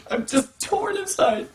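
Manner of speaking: high, soft, slow fake sob; mock anguish. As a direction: squeeze it out high and slow, hammed-up heartbreak. Mock anguish retelling the trauma.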